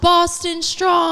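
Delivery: very loud, high, drawn-out shout; over-the-top rallying cry; mocking slogan. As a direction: holler it slowly, imitating the silly chant. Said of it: "when you say it like that".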